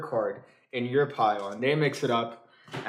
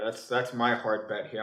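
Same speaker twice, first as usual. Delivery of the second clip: ordinary talk, nothing marked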